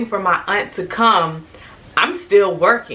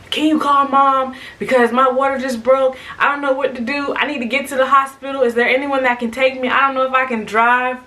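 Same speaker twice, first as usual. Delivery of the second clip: higher, rushed, pleading retelling; retold panicked plea; 6 semitones higher than usual. Calling sister after water broke, retold panicked plea. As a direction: act out a frantic phone call. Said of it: "Like, full on".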